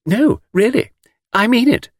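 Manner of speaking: put-on very high, girlish insistence; earnest young woman. A narrator's reading. Miss Taylor assures the guide, insistently.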